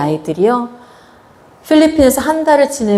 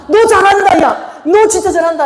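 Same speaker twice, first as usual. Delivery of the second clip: imitated cheering; 9 semitones higher than usual. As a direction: cheer them on from the crowd. Leaders cheering on the dancing kids.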